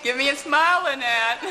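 playful, giggly call to a friend; laughing. Real, not acted. Calling to friend while filming.

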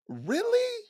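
high, sharp disbelieving really; incredulous. Real, not acted. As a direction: scoff in disbelief. Mocking the thrift store logic.

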